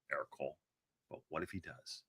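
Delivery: ordinary delivery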